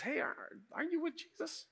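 high-pitched mock bystander voice; curious bystander. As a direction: imitate a hesitant questioner, way up high. Voices bystander questioning Peter by fire.

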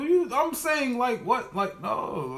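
high disbelieving squeak; incredulous. Real, not acted. Reacting in disbelief.

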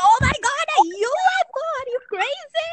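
very high, incredulous outburst; mock astonished shriek at absurd news; acted shock. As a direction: pitch way up in exaggerated disbelief. Acting out shocked reaction.

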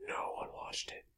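whispered punchline; whispering. Real, not acted. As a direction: drop to a whisper.

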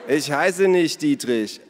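loud, slow, high objection; annoyed protest. A narrator's reading. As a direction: insist on it, each word stressed. Acted squabble over nickname.

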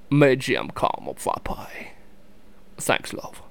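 soft, slow British accent; badly faked British film-star accent; Michael Caine. Shown as a demonstration. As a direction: order politely in a hushed British drawl. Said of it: "I tried to do a Michael Caine impersonation".

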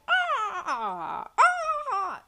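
high-pitched bird-like imitation scream; drawn-out crow-like mock scream. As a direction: squawk out a pretend scream like a crow.

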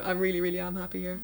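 ordinary talk

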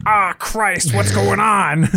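a mild mock grumble from the neighbor dad; annoyed tennis dad. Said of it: "is like".

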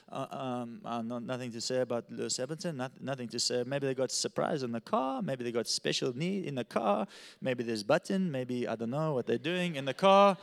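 put-on low, halting German-accented English; exasperated German driver, pitched low; frustrated German accent. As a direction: stumble through it with a German accent. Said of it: "He's frustrated".

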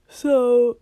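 loud yawn through the word. Real, not acted. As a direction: let a big yawn swallow it. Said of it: "yawning so much".

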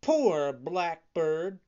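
slow, loud, dismayed cry; pitying puppet. A narrator's reading. Puppet dismayed at the eaten bird, pitying cry. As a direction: exclaim it stretched out, voice lifted. Pinocchio shocked at bird's death.

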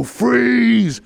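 loud high FREEZE bellowed; shouted robbery command; retold robber yell. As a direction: storm in and yell it. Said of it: "and he'd go".

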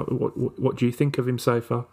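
ordinary talk, nothing marked

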